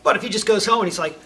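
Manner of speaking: usual speaking voice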